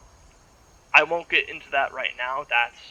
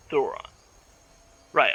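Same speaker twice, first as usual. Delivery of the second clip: normal talking voice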